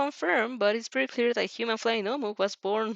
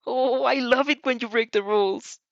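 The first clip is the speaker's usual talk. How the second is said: teasing character voice; 4 semitones higher, 5 dB louder than usual. Acted.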